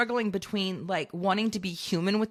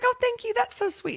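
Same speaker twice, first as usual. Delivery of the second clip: much higher, sugary polite voice; sweet high thanks; 14 semitones higher than usual. Retold or imitated. Thanking the kind DMV clerk in a high sweet voice. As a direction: lift it way up, sweetly gracious.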